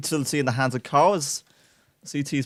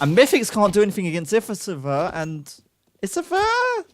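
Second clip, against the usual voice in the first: rising to a high shouted name; excited kill call; 6 semitones higher, 3 dB louder than usual. Real, not acted. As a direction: build the call, then cry out the player's name. Calling a kill live.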